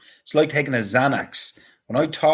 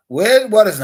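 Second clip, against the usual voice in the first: pizza man on the phone; 10 semitones higher, 11 dB louder than usual. Retold or imitated.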